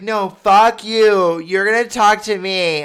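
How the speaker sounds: loud, high, drawn-out angry yell; retold screaming. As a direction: imitate a girlfriend yelling in fury. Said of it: "they're just both screaming at me".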